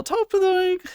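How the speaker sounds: high falsetto Irish lilt on a joke phrase; Irish accent. Shown as a demonstration. Streamer tries an Irish accent for chat, high falsetto lilt. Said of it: "this is gonna be terrible".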